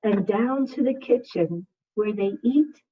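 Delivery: gentle, slow storybook reading; reading to children. Acted. Second grade teacher reading a picture book to her class, soothing.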